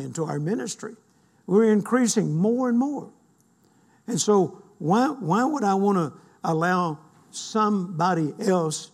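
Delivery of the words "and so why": ordinary talk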